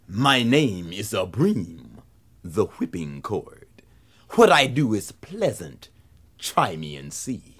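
low, slow, deliberate voice; ominous whipping cord. A narrator's reading. Whipping cord introducing itself to Anansi, low and ominous. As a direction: draw it out low, with menacing politeness.

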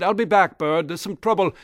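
loud, high alarm; urgent call. Acted. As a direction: call back as you rush off. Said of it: "and the leprechaun said".

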